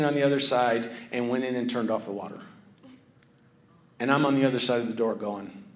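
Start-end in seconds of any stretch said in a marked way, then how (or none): none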